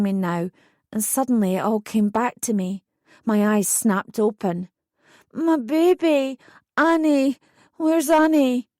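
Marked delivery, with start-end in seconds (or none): 5.3-8.7 groggily. A narrator's reading. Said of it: "I asked groggily".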